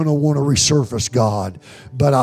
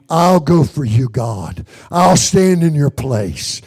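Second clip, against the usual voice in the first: raised, insistent pledge to God; fervent preaching prayer; 4 semitones higher than usual. Real, not acted. Preacher offers to stand for God anywhere, fervent prayer. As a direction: declare each promise with rising fervor.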